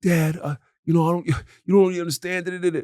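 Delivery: put-on high, fast whiny teenage voice; mocking teen daughter. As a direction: whine it like an exasperated teen brushing dad off. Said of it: "Like, like".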